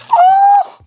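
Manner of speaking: sudden high startled cry; surprised yelp. Real, not acted. Peeling hot tumbler, sudden.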